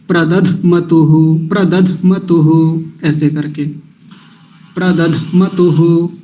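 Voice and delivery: प्रदध मतुहु slow, a little jolt between प्रदध and मतुहु; प्रदध मतुहु with a small push on मतुहु; with a slight jolt. Shown as a demonstration. Teacher showing the jolt in प्रदध मतुहु. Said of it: "कैसे?".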